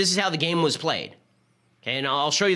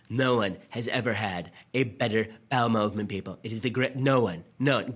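Trump-style bragging. Retold or imitated. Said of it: "President Trump is the kind of fellow who likes to say".

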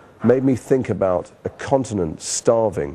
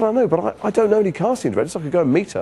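high-pitched, hurried pleading; re-enacted panicky excuse, sinking back down; flustered protest; 10 semitones higher than usual. Acting out his flustered protest to agent.

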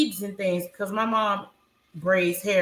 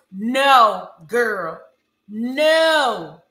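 loud, scolding disbelief; outraged; 4 semitones higher, 5 dB louder than usual. Real, not acted. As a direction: scold her, louder and louder. Mocking rant at Alex's hoops.

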